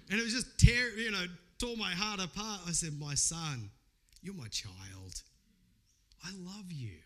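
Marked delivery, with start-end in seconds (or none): 3.0-7.0 softer, lower and slow, gently consoling; soft father's reassurance to a weeping son; tender fatherly reassurance. Retold or imitated. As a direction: hush down and comfort a crying child. Father comforting son in story.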